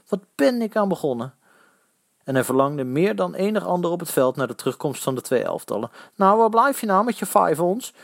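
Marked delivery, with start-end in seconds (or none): 0.1-1.3 high dismayed thought; worried inner wail; uneasy, rising lament. A narrator's reading. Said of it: "voelde zich allesbehalve op zijn gemak". 6.2-7.9 high, teasing jeer; raised, high-pitched taunt; needling. A narrator's reading. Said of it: "sarde de slagersknecht weer".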